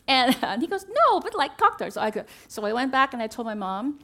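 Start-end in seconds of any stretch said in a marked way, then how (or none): 1.0-1.9 high, loud imitation of an insistent husband; exasperated insistence. Husband correcting his wife's misunderstanding, mock exasperation. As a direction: push back, pitched up, as if it's obvious.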